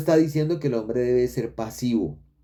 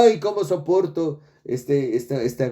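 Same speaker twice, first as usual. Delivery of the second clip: mock lament; 3 semitones higher than usual. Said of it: "y usted diga".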